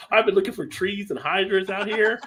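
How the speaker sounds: a hint of a dog amazed at the easy option; lightly voiced dog. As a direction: play the put-out dog, lightly. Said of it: "The dog is like".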